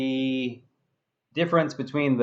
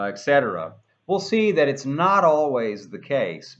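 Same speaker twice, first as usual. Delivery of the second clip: ordinary talk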